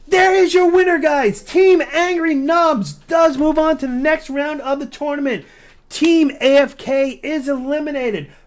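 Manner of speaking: loud, high winner call; shouting winner. Real, not acted. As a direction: yell the victory out. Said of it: "Almost lost my voice right there".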